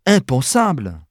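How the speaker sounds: outraged outburst; indignant exclamation. A narrator's reading. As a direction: exclaim it as a dismayed, delicate lady. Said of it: "s'écria Mercedes".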